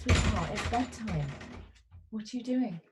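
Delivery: soft mum coaxing her son to bed; tucking in at bedtime. Real, not acted.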